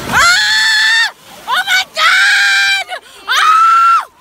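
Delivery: high, loud shriek of fright; terrified scream. Real, not acted. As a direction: scream with real panic. Cold wave reaches her.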